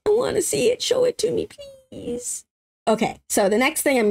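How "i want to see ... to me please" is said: high, whiny mock plea; hammed-up pleading, high-pitched; playful begging. Begging to see more of it.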